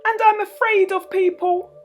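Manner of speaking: high, meek little man's voice; timid little man. Acted. Mister Bashfellow explains his machines to the kids, high and timid. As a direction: confess shyly.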